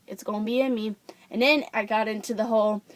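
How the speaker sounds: usual speaking voice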